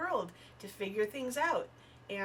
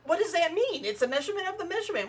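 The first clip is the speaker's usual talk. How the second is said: high, loud mock exasperation; exasperated questioning; 4 semitones higher, 6 dB louder, faster than usual. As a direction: rattle it off, incredulous at the absurdity. Acts out exasperated questioning about metrics.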